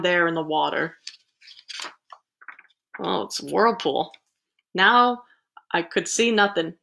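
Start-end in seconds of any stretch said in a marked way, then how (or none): none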